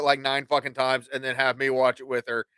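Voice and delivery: usual speaking voice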